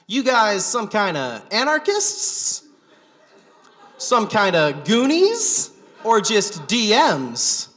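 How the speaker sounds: loud, drawn-out high questions; loud questioning. Retold or imitated. Stranger asks the guys what they are, loud questioning. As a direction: imitate a stranger quizzing loudly, slowly.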